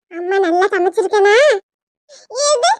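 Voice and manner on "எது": high-pitched indignant yell, last word drawn out; piercing, dragged-out shout. Acted. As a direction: cry out in shocked disbelief, stretching the name. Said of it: "ஏன் இப்ப கத்திக்கிட்டு இருக்க".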